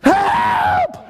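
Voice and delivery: high, loud mock scream; one long hammed-up cry for help. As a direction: scream as if the ride never stopped. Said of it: "I'm still screaming".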